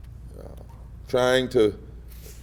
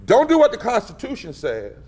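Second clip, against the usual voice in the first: high-pitched emphatic imitated order; quoted instruction; 8 semitones higher than usual. Party director instructing board members, high and emphatic. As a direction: press the order home, pitch lifted. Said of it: "sent a letter saying to those".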